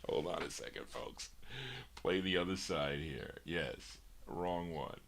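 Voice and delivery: dropped way down, half to himself; soft aside while swapping the record; quiet off-mic aside. Real, not acted. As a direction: mutter it away from the mic, fixing the mistake. DJ muttering off-mic over mistake.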